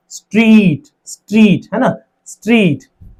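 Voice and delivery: Street with a long held ee, each time; one long syllable. Shown as a demonstration. Teacher showing a single syllable, street drawn out long. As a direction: stretch street as a single unbroken beat. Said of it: "एक ही syllable है इसमें".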